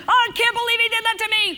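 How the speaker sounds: hammed-up indignant shriek; mock fury. Shown as a demonstration. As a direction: ham up being wronged for the crowd. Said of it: "Okay, get angry".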